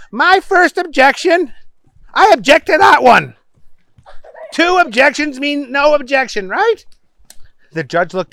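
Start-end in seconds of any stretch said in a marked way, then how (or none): 0.1-6.8 high-pitched muddled reasoning; argumentative Booger. A narrator's reading. Booger explaining double objection to judge, high and insistent. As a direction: argue eagerly, ending very high.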